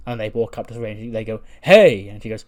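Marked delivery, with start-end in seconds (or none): 1.6-2.1 loud, higher acted "hey"; called-out greeting. Two friends walking up to Saray, calling out a greeting. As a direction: call out a big hello.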